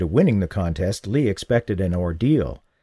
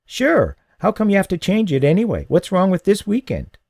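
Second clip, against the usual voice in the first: put-on higher, eager kid listing ideas; lightly voiced boy Paul; 9 semitones higher than usual. A narrator's reading. The boy suggesting gifts for his uncle, lightly voiced.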